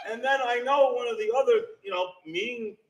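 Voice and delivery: ordinary delivery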